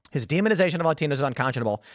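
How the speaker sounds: rattled off fast in one go; quick, run-together quote with a falling end; complete-sentence demo. Shown as a demonstration. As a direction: read it straight through quickly and close it off.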